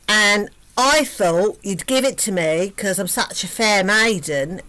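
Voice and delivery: loud, brash, rude demand; rude loud princess. Acted. Princess yelling threats at the Minotaur, loud and rude. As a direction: bellow it at the Minotaur like a spoilt brat.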